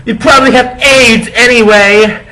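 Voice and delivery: high, loud spiteful yell; angry shout. Acted. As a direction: spit it out furiously. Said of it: "I shouted".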